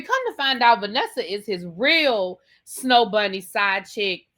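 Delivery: ordinary talk, nothing marked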